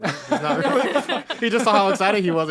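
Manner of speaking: loud laughter through the words; laughing. Real, not acted. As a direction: laugh while you explain.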